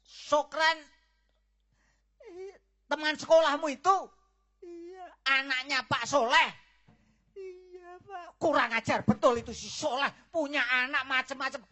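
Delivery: loud, high, shocked then raging; furious outburst. Acted. Father erupts in fury at the boy's family. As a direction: explode on hearing the name.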